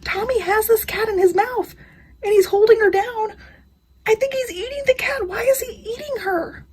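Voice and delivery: high, near-crying voice of alarm; on the verge of tears, pleading; distressed panic. Retold or imitated. Telling husband the cat is being eaten, near tears.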